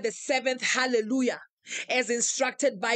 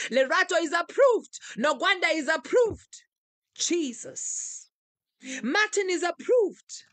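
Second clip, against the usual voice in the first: preaching declaration; 6 semitones higher, 4 dB louder than usual. Acted. Preacher declaring members approved, full-force preaching. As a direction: proclaim each name like a blessing from the pulpit.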